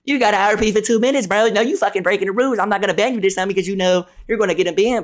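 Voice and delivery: lightly voiced scolding woman; mocking the woman. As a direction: parody her scolding. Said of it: "last time I tried to rob a female".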